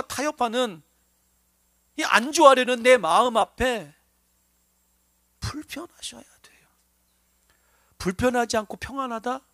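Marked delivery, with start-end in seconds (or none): 5.4-6.7 불편하셔야 돼요 breathed in a soft whisper. Acted. Preacher whispering the exhortation to the congregation. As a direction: drop to a hushed whisper on 불편하셔야.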